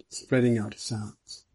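plain reading voice

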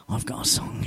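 hushed whisper; a sudden unvoiced whisper. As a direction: breathe the words in a whisper. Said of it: "Why am I talking like that?".

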